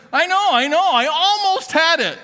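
high, eager mock excuse; imitating a proud ballplayer who nearly made it; sheepish [name]. [name] answers the shortstop in a high, keen voice.

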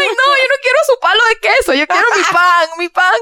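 voz mucho más aguda, quejumbrosa, exagerando el apuro; hammed-up whine, insisting she only wants bread; exasperated protest. Retold panicked self at restaurant. (se queja exageradamente, muy aguda, que solo quiere su pan)

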